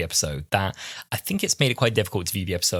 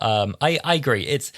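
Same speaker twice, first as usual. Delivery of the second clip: ordinary talk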